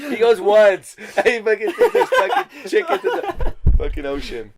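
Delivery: loud, high-pitched teasing through laughter; shouting it out between laughs; laughing mockery. Real, not acted. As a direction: crack up while mocking your friend. Teasing friend while cracking up.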